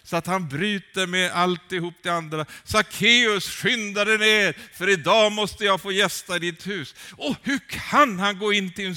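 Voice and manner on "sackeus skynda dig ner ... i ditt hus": high, loud, half-sung call. Retold or imitated. Jesus calls Zacchaeus down from the tree, loud and ringing.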